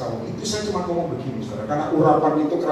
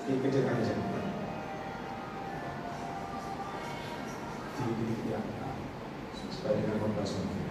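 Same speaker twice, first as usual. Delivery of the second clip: quiet aside; 5 semitones lower, 12 dB quieter than usual. Real, not acted. Aside asking for sound turned down.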